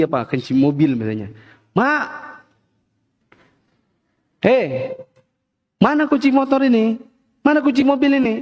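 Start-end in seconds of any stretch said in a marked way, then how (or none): 1.8-8.3 high-pitched call through the house; acted holler to someone in another room; father yelling. Father calls mama in the kitchen for keys, yelling. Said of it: "Teriak dia, misalnya mamanya di dapur".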